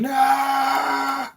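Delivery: very high, loud, drawn-out scream. Pretend. Banshee's victim drops to his knees, screaming.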